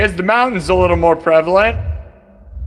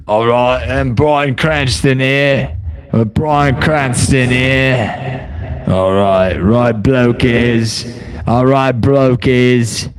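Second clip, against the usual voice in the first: low, slow mock British accent, lightly done; a bad British bloke accent, drawn out; 4 semitones lower, much slower than usual. Vlogger mocks a British-looking AI Bryan, in a mock British accent. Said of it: "He looks so British".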